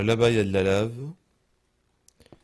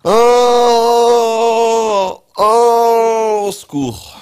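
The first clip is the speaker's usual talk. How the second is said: long loud high wail of "help"; panicked loud cry; 13 semitones higher, 15 dB louder, much slower than usual. Real, not acted. Streamer panicking in the game, screaming loud and high for help.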